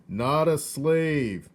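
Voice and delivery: loud, slow shout; loud, slow emphatic shout. A narrator's reading. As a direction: drag it out loudly. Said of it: "shouted the soldier".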